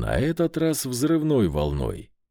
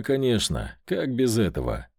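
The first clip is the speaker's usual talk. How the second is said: ordinary delivery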